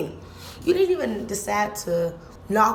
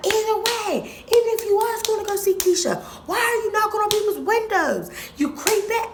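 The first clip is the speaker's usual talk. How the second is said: mom scolding; 13 semitones higher than usual. Retold or imitated.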